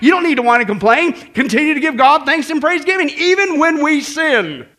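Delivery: loud, driving sermon delivery; full-force preaching. Real, not acted. Preacher answering the devil with scripture, forceful preaching. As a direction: hammer each phrase home to the congregation.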